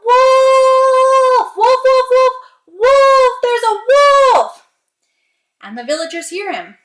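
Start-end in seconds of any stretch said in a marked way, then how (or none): 0.1-4.7 desperate high, drawn-out scream for help; yelling. Acted. Peter warns the village of a real wolf, yelling. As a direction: cry wolf at full voice. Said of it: "he yells out".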